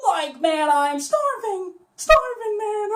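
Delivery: high Shaggy whine; goofy hungry stoner. Shown as a demonstration. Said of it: "once you get that high part down".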